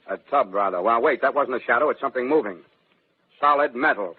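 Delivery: normal talking voice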